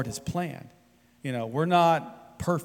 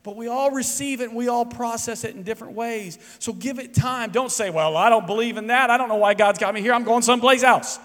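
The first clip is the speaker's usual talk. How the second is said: raised, high, loud preaching voice; full-force preaching; 8 semitones higher, 4 dB louder than usual. Acted. Pastor urging the congregation to be patient, full-force preaching. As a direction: preach it out to the whole room.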